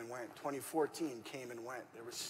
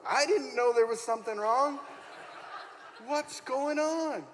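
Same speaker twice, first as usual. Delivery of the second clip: much higher and louder, laughing through the words; laughing disbelief; 12 semitones higher, 10 dB louder than usual. Retold or imitated. Husband hearing the pregnancy news, laughing in disbelief. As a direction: caught off guard and cracking up. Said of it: "We're laughing and crying on the phone".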